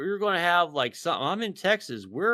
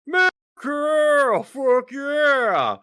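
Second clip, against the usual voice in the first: high, slow, loud battle cry; patriotic yell; 12 semitones higher, 8 dB louder, much slower than usual. Retold or imitated. Cheering America from the tank, a slow, high triumphant yell. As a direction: roar it like a victory chant.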